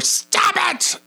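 sudden high-pitched parental outburst; exasperated yell. Retold or imitated. Parent who's had it with his kid, imitated yell. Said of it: "when we do get loud with him".

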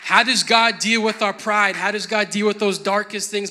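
loud, rising sermon, building as it goes; full-force preaching. Acted.

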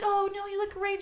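high, reassuring gush; super supportive. Retold or imitated. Imitating a teacher reassuring Carrie about her looks, super supportive. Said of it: "she's being super supportive".

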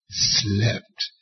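preacher hushing the congregation; hushed repeat. Acted. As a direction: let "slept?" sink quietly. Preacher repeats the question softly.

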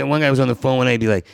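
ordinary talk, nothing marked

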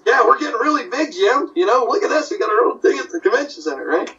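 high-pitched eager patter; upbeat retold reply. Artist boasting to Jim about the convention, upbeat. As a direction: talk it up to an old friend.

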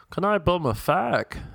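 high-pitched put-on Dublin accent; Dublin Irish accent. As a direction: do a girl at the bar in a lilting Dublin accent. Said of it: "Dublin Irish accent".

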